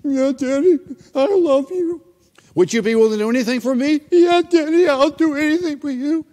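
put-on high, quivering little girl about to cry; tearful little girl. Girl near tears answering her father, high and trembling. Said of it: "getting her lip like this".

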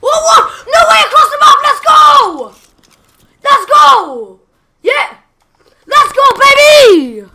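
high, loud triumphant yelling; ecstatic cheering. Real, not acted. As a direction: cheer like a winner. Cross-map kill, ecstatic cheering.

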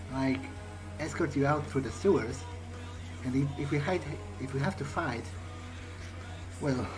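ordinary talk, nothing marked

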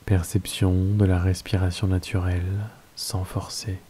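calm, unhurried Yoga Nidra guidance with long pauses; guided meditation. Acted. Teacher settling listeners into Yoga Nidra, guided meditation voice.

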